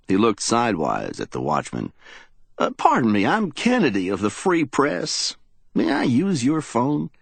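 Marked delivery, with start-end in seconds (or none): 2.6-7.1 polite reporter. Acted. Reporter voiced in a story.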